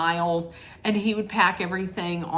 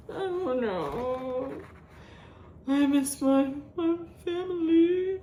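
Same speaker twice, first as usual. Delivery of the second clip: higher, softer, hammed-up sad whine; mock whimper; 6 semitones higher, 4 dB quieter, slower than usual. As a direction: whimper it like a pitiful homesick woman. Mocking Meredith missing family.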